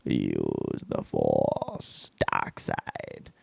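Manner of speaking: very slow, hushed whisper; whispered Force voice. Acted. Comic acting out Star Wars, slow eerie whisper. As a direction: breathe it out like a ghostly Jedi voice.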